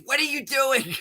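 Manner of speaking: high-pitched imitation of a startled friend; mock startled demand. Acts out Siegel catching him.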